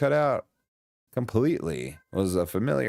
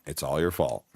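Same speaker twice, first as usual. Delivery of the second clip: mock accusing voice; 10 semitones lower than usual. Echoes game character's accusing line.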